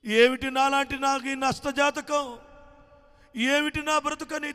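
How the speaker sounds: high, loud, slow wailing lament; crying mother's lament. A narrator's reading. Abandoned mother wailing under the tree, high and loud. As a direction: wail it out through tears. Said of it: "ఏడ్చుకోవటం మొదలుపెట్టింది".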